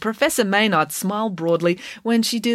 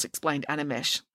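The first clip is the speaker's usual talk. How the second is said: ordinary delivery, nothing marked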